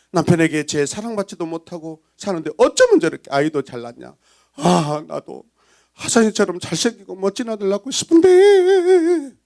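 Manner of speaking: gossipy envy rising to a wail; envious village women. Acted. Village women envying Leah's fine sons, hammed-up envy. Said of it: "동네 여인들이 부러워하고 있어요".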